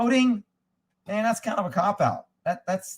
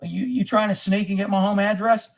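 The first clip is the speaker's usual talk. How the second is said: normal talking voice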